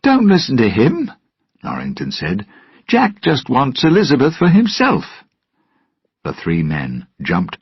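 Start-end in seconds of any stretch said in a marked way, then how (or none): 0.0-5.0 higher, louder angry retort; Norrington's retort. Acted. Norrington angrily warning Will, higher and louder. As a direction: cut Jack down in front of Will.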